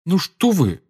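Harry protesting to comfort Molly. A narrator's reading. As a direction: object with a forced smile. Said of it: "запротестовал Гарри".

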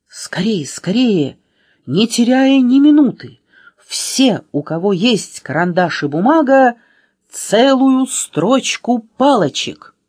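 rally the listeners to help at once; urgent appeal. A narrator's reading. Narrator begs children to save Аля, urgent.

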